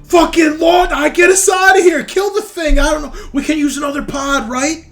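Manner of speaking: high, loud panicked shouting; desperate, very high-pitched yelling; terrified Hobbs. Acted. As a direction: shriek it in a frantic panic. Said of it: "Hobbs looks terrified".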